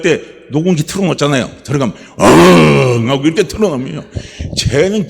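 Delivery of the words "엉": 크게 으르렁 흉내; 녹음기 사자 소리처럼 으르렁. Retold or imitated. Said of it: "이렇게 하잖아요". (사자 포효 흉내)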